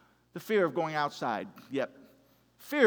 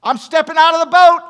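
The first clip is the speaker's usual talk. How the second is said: high, loud proclamation; bold, excited shout; 9 semitones higher, 16 dB louder than usual. Acted. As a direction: call it out with full bravado. Acts out Peter boldly leaving boat.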